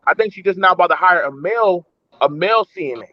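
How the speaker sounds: ordinary talk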